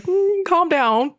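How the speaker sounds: high, drawn-out imitation of reining someone in; hammed-up soothing put-down; mock placating. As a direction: stretch it out high, playfully telling them to settle. Voicing writers reining in their praise.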